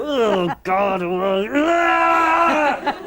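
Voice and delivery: high, loud yelp of agony building to a shout; pained cry. Retold or imitated. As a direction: cry out as the tights bite. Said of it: "You know, you go".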